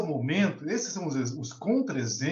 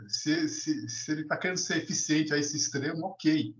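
ordinary talk, nothing marked

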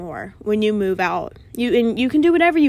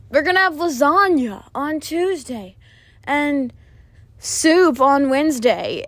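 imitating mom; 4 semitones higher, 3 dB louder, slower than usual. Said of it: "She's like".

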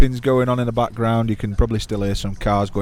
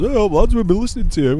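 higher, brighter imitation of fans; quoting eager visitors, pitched up; excited fan voice; 11 semitones higher, 3 dB louder than usual. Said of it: "we keep getting people wandering over to us".

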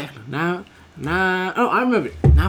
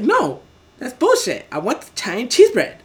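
mock stubborn refusal, raised pitch; irate customer; 6 semitones higher than usual. Customer rejecting the cheaper deal, mock irate refusal. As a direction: refuse the offer in a higher, slowed voice.

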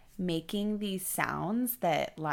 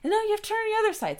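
much higher, very fast correction; hurried correction; 5 dB louder, much faster than usual. Retold or imitated. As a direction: rush the order. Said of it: "they start going".

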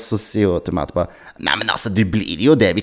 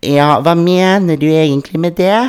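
imitated Trøndelag accent, pitched higher and louder; trøndersk dialekt; 9 semitones higher, 6 dB louder than usual. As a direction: play the nurse in a Trøndelag accent. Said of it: "Nå ble jeg trønder plutselig". (trøndersk aksent, etterlignet sykepleier)

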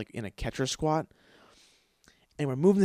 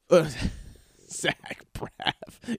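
breathy laughing repeat; 3 semitones higher, much slower than usual. Shown as a demonstration. Repeating the actor's name to himself, laughing whisper. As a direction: whisper Zach Braff through a laugh.

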